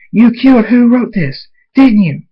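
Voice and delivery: high, loud confrontation; heated accusation. Acted. Dave accusing Fluttershy of killing, angrily. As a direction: throw the charge at them loudly.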